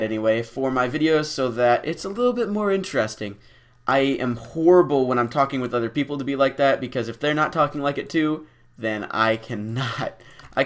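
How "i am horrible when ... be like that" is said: normal talking voice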